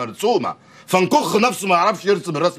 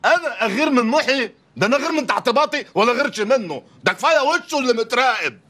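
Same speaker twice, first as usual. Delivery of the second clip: indignant denial; 6 semitones higher than usual. Acted. Indignantly denies jealousy of friend.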